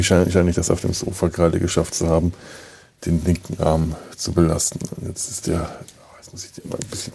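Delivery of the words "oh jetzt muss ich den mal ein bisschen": whispering. Real, not acted. Moving an aching arm.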